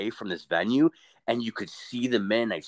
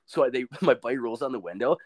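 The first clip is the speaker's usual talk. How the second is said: ordinary talk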